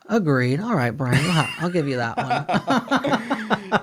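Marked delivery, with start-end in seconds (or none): 1.3-3.8 slow, high, amused give-in; laughing concession. Real, not acted. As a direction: concede while chuckling.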